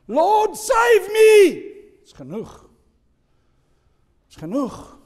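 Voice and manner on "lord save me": much higher, loud shout for help; desperate cry. Acted. Peter sinking in the waves, shouting to Jesus in panic. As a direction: scream it as you go under.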